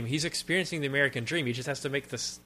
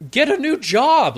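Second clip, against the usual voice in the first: mock retort; 12 semitones higher, 8 dB louder than usual. Mocking retort at character.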